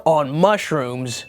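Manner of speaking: slow, put-on bro voice; mock bro ad voice. Mocking the mushroom ad's pitch in a slow bro voice. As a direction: drawl it out like a bro hyping a product. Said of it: "another advertisement for them that says".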